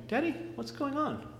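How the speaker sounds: put-on high, soft little boy's voice, confused; a frightened small boy asking his dad; small child voice. Son on hospital table asking father, high soft child voice.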